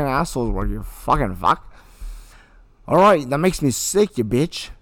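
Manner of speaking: acting a bully's hateful scene from Mean Creek; acted rage. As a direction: tear into him with pure contempt. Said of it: "begin when you're ready to begin".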